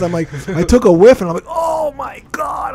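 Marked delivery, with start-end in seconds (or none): 1.5-2.7 very high, slow, drawn-out cry of disgust; disgusted shout. Retold or imitated. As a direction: smell it and recoil. Whiff after checking pants.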